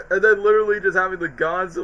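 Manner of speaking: ordinary talk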